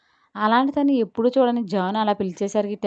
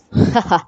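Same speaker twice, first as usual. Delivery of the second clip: a louder, short falling laugh; loud burst of laughter; 7 dB louder than usual. A narrator's reading. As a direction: laugh out at his teasing. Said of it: "అని నవ్వి". (గట్టిగా నవ్వు)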